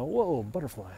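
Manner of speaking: normal talking voice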